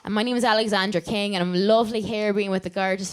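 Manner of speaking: put-on light Irish accent, cheerful host. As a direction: chat like a lively Irish host. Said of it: "do your Irish accent".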